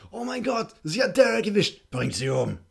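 hoch und laut, panischer NPC-Ruf; aufgeregter Gegner. Retold or imitated. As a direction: spiel einen aufgeschreckten Gegner, der Alarm schlägt. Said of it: "So nach dem Motto". (panischer Gegnerruf)

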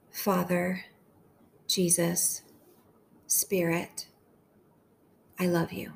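gentle, unhurried meditation voice with long pauses; hushed, measured devotional leading; guided prayer meditation. Acted.

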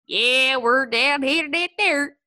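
loud, high Southern American drawl; mock Deep South American. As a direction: ham up a Deep South accent. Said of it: "an Italian referring to Americans".

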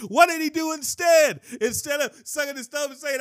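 high, loud shouted challenge; fiery pulpit shout; preaching shout. Real, not acted. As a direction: shout it out to the congregation.

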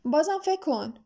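a touch of reproach; reproachful. A narrator's reading. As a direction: chide him briefly.

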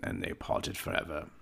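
plain reading voice

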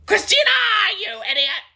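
high shrieking put-on yell; mock shriek. Imitating a screaming dance coach. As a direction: screech it like a furious dance coach. Quoting Abby Lee Miller.

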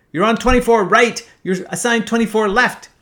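loud, high imitated controller yell. Controller correcting the pilot's runway, yelling. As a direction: bellow the correction over the radio. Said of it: "He yells".

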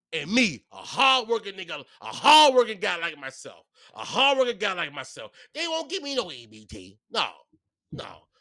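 ordinary talk

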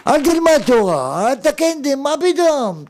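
high, protesting tempter; acted tempter scoffing in high pitch; evil inclination's voice. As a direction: talk someone out of it in a high voice. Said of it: "יצר הרע".

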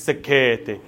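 "sacchetti" with an exaggerated Italian flourish; mock Italian accent. Local waiter insisting on the pasta's name, put-on Italian accent. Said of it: "said in an Italian accent".

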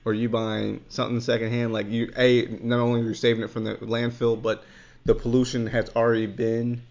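ordinary talk

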